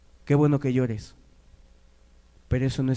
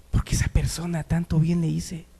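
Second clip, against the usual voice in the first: mildly wounded protest, rising higher; acted hurt disbelief; 7 semitones higher, 6 dB louder than usual. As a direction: protest like someone let down by a friend. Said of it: "tú te entristeces y te duele y dices".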